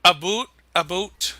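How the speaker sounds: "boot" with an exaggerated Canadian oo; Canadian vowel. Shown as a demonstration. Guest attempting Canadian, "boot" with a long oo for about. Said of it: "don't they say, like".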